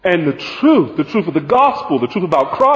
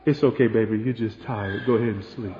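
low, soft, lulling coo; soothing voice; 7 semitones lower, 7 dB quieter than usual. Retold or imitated. Neighbor lulling a drowsy man in a burning house, soft and soothing. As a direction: coax him gently back to sleep. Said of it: "saying in a soothing voice".

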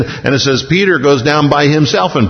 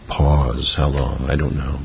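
hushed; 10 semitones lower, 7 dB quieter than usual. Real, not acted. Quiet reflective pause in sermon.

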